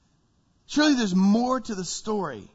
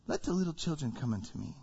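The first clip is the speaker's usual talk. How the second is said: much softer, lower and tender; gentle Jesus; 5 semitones lower, 10 dB quieter, faster than usual. Acted. Jesus welcoming children, soft and tender. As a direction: say it like a kind neighbour, not a fighter.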